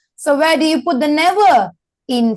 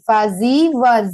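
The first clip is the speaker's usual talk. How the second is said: slow, careful reading, word by word; slowly. Shown as a demonstration. Teacher reads slowly together with the class, slow. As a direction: read it slowly so the class can follow. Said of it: "let's read it together slowly".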